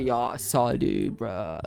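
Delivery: put-on low, slow frat-boy drawl. Asked for his best American accent, low frat boy. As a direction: drop low and drag it out like a frat bro. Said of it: "You want frat boy?".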